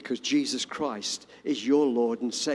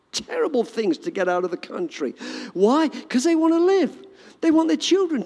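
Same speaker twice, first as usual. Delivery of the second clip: impassioned preaching; 7 semitones higher than usual. Acted. Pastor stirring the congregation, impassioned high preaching. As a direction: rise up with fervour.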